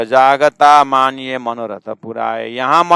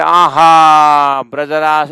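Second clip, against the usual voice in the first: slow, loud, high cry of delight; rapturous exclamation; 4 semitones higher, 8 dB louder, much slower than usual. Real, not acted. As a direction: savour the word in awe. (आनंद से खिंचा हुआ उद्गार)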